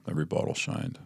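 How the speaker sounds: whispered, almost breathless, every bottle shined; quiet whisper of awe at the bottles; hushed awe. Retold or imitated. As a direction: breathe it out like a temptation. Repeating in awe of minibar.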